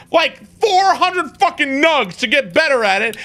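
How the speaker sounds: normal talking voice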